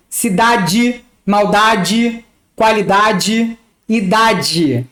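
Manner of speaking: cidade, maldade, qualidade, idade ending in "dji"; final e as i. Shown as a demonstration. Teacher listing words with a final i sound. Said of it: "O E tem som de I".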